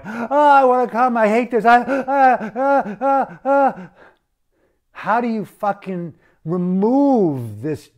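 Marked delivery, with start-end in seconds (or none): 0.0-3.9 much higher and louder, strangled moans; mock tortured moaning. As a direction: ham up a man overwhelmed and groaning. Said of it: "And all of a sudden you're going".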